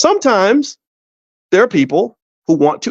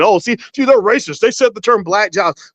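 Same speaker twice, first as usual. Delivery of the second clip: mock accusing; 6 semitones higher than usual. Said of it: "the Republicans would be the ones saying".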